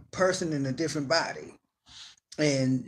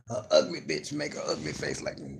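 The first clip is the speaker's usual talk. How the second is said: ordinary talk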